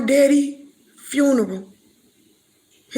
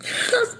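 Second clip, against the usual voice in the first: crying laugh; 13 semitones higher than usual. Real, not acted. Breaks down recalling father.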